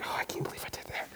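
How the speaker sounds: breathless embarrassed whisper; unvoiced, quick whisper. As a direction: hiss it under your breath in regret. Our own regret after overreacting.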